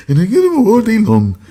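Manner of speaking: high, loud squealing dim giant; Mongo voice. Retold or imitated. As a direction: squeal it out in delight. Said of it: "the guy".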